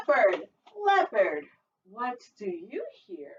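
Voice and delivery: put-on sing-song read-aloud for little kids; storytime reading. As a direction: read it like a lively picture book. Teacher reads picture book aloud.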